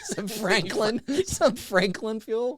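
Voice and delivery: laughing. Real, not acted. Laughing through the flubbed ad.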